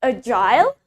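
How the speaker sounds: "agile" ending in a full "-ile", like "aisle"; British "agile", A-jile, voice rising; British pronunciation. Shown as a demonstration. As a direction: model "agile" with a long eye sound before the L. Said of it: "we pronounce it as agile".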